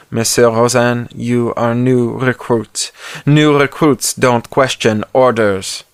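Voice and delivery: put-on slow, lightly French-accented menace; a hint of a cold French sergeant laying down the rules. French sergeant threatens the recruit.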